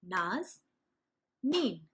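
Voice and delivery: Naz and Neem said as two clapped chunks; clapped syllables. Shown as a demonstration. Teacher showing how to clap out the syllables of a name. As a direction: say Naz, pause, then Neem, one clap each. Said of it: "it would go like this".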